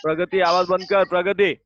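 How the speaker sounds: loud, sharp call across the room; calling out names. Real, not acted. Teacher calling out chattering pupils, loud stern call. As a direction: snap the names out over the noise.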